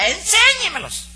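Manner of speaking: hammed-up falsetto of a fussy customer; mocking Nananina's voice. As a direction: mimic the customer in a shrill falsetto.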